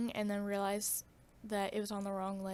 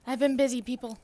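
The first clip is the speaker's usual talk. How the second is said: loud; 10 dB louder than usual. Real, not acted. Defensive outburst to viewers.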